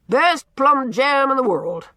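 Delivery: put-on loud, gruff, teasing old man holding forth; blustering old Norman. A narrator's reading. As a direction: boom it out like a cranky, likeable bully. Norman boasts.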